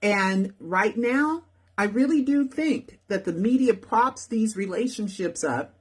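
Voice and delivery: usual speaking voice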